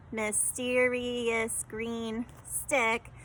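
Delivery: mysterious green stick, each syllable said slow and separate; counting syllables slowly. Shown as a demonstration. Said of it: "When I counted that".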